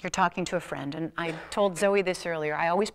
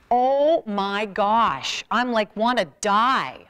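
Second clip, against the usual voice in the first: loud, very slow, drawn-out dread; hammed-up anguish over a silent room; mock despair; 3 semitones higher, 8 dB louder, much slower than usual. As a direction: ham up the dread. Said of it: "dreads silent Q and A".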